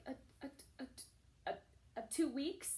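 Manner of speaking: stuttering up to a high question; halting, anxious false starts; nervous stammer. Retold or imitated. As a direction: re-enact stammering out nervous news. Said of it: "shaking so bad".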